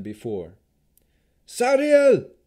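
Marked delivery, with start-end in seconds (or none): 1.5-2.3 very high, loud frantic call; piercing scream for someone missing; shrill, urgent scream. A narrator's reading. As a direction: cry the name out desperately. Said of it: "His screams became shrill with increasing urgency".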